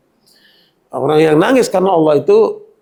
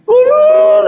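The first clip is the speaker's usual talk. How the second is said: mock wail; 4 dB louder than usual. Imitating woman crying over debts.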